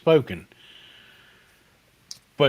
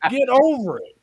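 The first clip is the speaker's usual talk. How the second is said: high, louder mock-scolding; sassy retort; 7 semitones higher, 5 dB louder than usual. Waitress teasing Chris, high and sassy. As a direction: dismiss him like a brat, pitched way up.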